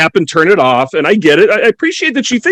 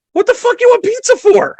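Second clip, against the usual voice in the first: high bewildered half-asleep question; baffled just-woken outburst; high groggy bafflement at the wife; groggy confusion. Retold or imitated.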